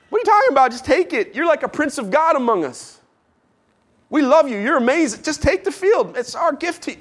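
high, pushy voice pressing the gift; eager insisting Hittite. Acted. Hittite elder pressing Abraham to accept the field, high and eager. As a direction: insist he just take it.

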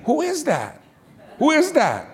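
high-pitched nagging wife imitation; suspicious wife. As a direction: press the question like a jealous wife. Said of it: "And my wife".

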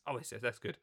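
usual speaking voice

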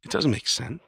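ordinary talk

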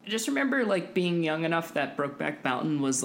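ordinary delivery

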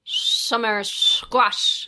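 very slow, whistly teeth sound, laughing; whistling through teeth. Shown as a demonstration. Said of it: "Say, say summer squash that way".

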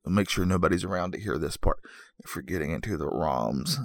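low, soft mock-secretive aside; hushed confiding. Host sharing a guilty secret with listeners, hushed confiding. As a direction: drop it down as if nobody should overhear.

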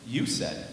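YOU leaned on, "said" dropping away low; stress on YOU. Acted. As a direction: punch YOU, then let the rest fall. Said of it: "Emphasis on the "you".

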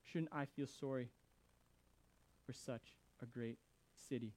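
very soft, low appeal; tender plea. A narrator's reading. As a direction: let it sink in, quiet and halting. God's plea repeated softly for effect.

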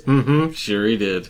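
knowing, sly agreement; sarcastic mm-hm. Retold or imitated. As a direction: drip with sarcasm. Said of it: "all I hear is".

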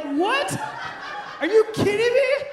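high, loud mock disbelief; incredulous outburst. Husband cornered by teasing friends, incredulous outburst. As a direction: shoot way up in pitch, loudly protesting. His reply to the group's pressure.

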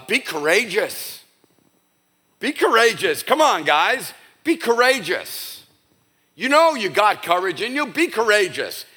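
insistent hammed-up rallying; mock pep talk. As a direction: imitate someone exhorting the guys to toughen up. Mock pep talk Jesus doesn't give.

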